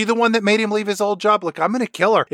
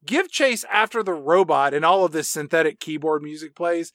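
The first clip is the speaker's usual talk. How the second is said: usual speaking voice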